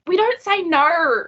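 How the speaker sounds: 抗議するように; mock indignant protest. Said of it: "but I'm like".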